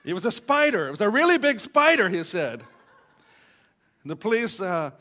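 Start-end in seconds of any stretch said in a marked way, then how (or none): 0.0-2.1 much higher and louder, rushing, insisting it was a spider; exasperated big-spider insistence, loud and fast; emphatic insistence. Retold or imitated. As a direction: blurt it out, defending yourself. Man insists on spider explanation.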